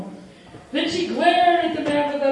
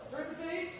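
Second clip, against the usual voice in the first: ordinary delivery, nothing marked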